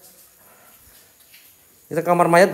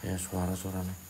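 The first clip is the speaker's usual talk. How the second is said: much softer, lower murmur; softer, lower hush, listening for sounds; 10 semitones lower, 18 dB quieter than usual. Real, not acted. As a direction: keep it down so nothing hears you. Hearing noises in haunted building.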